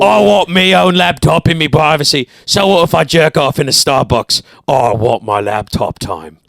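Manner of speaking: put-on British tough-guy accent; Jason Statham. Demanding laptop privacy, mock British tough-guy accent. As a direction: demand it like a British hard man. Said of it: "Jason Statham".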